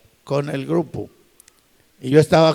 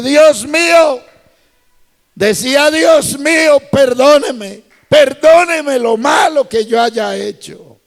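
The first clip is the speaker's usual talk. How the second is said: high, loud begging cry of Dios mío; retold crying plea; 11 semitones higher, 6 dB louder than usual. Policeman weeping to God asking forgiveness, high loud plea. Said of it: "él comenzó a llorar".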